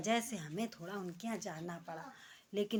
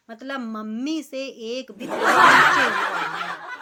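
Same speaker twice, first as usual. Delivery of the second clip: laughs through the line, slow and loud; laughing; 3 semitones higher, 12 dB louder, slower than usual. Real, not acted. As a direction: burst out laughing at the absurdity. Laughing at modern daughters-in-law. (हँसते हुए बोलना)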